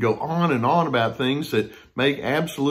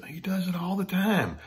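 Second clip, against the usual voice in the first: whispered; 7 semitones higher, 6 dB quieter than usual. Retold or imitated. Wife confiding her complaint.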